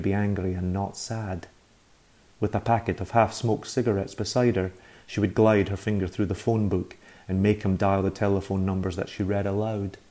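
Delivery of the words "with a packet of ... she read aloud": plain reading voice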